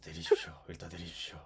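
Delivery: deep, low movie line impression; extremely deep bass voice. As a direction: drop the voice low and firm. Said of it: "잘해, 잘해".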